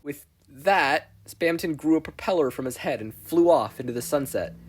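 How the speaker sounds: ordinary delivery, nothing marked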